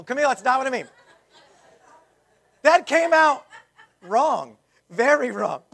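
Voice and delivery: laughing through an embarrassed apology; real embarrassed laughter, words bursting out high and loud. Real, not acted. Embarrassed joke about Southern people.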